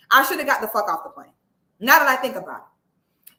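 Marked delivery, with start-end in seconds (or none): none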